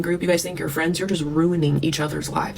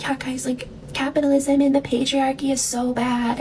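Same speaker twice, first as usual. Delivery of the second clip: much higher, childish mock voice; little girl voice; 9 semitones higher than usual. Mocking Chelsea as an eight-year-old, high childish voice. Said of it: "this eight-year-old version of herself".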